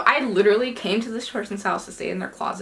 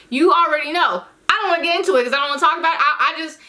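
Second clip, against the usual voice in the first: high, louder exasperated outburst; 8 semitones higher, 4 dB louder, faster than usual. Real, not acted. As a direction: throw up your hands at the audience. Exasperated, pitch jumps.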